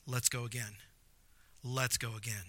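soft, quiet, patient voice of God; a quiet, tender invitation to try again; gentle invitation. Acted. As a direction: coax them back softly.

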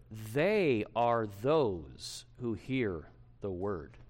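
"They" drawn out slowly, leaning on the plural; very slow, weighted "They" and "those"; stress on THEY. Shown as a demonstration. Preacher showing that we do this together, stressing "They". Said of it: "you notice we do this together".

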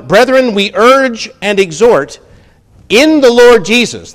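high, loud, ringing proclamation; full-force preaching. Acted. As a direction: hammer it out to the congregation. Reads verse at full force.

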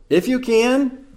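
"If you can" naik tinggi seperti pertanyaan; tanya dengan nada jauh lebih tinggi pada "can"; question. Shown as a demonstration. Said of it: "Question mark".

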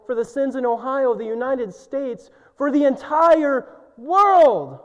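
rising from firm to a loud high cry; building preaching. Real, not acted. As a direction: build it up till you cry out the whole world.

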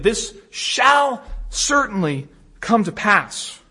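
"shall" drawn out and punched, slow; emphatic. Acted. Preacher translating the Greek for listeners, slow and emphatic on "shall". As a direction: hit "shall" hard, then measured "certainly come to pass". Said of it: "it's an emphatic yes".

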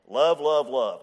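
loud, mocking chant of the word love. Teacher mocks the world's slogan to the church, loud mocking chant. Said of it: "That's what he said".

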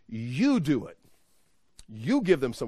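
"You" leaps up in pitch each time; emphatic YOU. Shown as a demonstration. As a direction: hit "You" hard at the start of each sentence. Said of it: "emphatically, it's".

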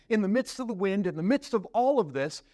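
usual speaking voice

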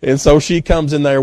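ordinary talk

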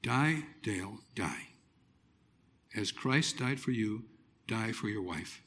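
a hushed private vow to himself; silent self-talk. Retold or imitated. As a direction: say it to yourself under your breath. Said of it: "I said to myself, silently".

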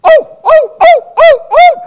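high barking yelps, dog imitation. Guard dog barking at a thief at two in the morning, high yelps.

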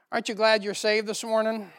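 normal talking voice

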